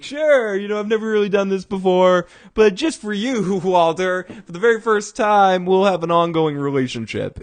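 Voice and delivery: high, loud, gushing mock-flattery; eager salesman. Vacuum guy fawning over Walter, high and loud. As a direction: lay it on thick like a fawning salesman.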